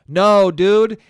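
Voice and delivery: loud, exasperated comic rebuttal; emphatic mock outrage. Podcaster shooting down Apple's idea, loudly mock outraged. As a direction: burst out loudly in playful indignation.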